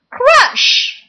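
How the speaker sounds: high, punchy sound-effect call; dramatic crash. A narrator's reading. Sound effect word read aloud.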